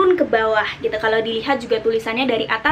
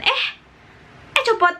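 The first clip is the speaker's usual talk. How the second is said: "eh copot" jumps much higher, sharp falling; startled cry; 12 semitones higher than usual. Shown as a demonstration. Teacher showing tone four as a startled cry. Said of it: "ingat aja ketika kalian kaget".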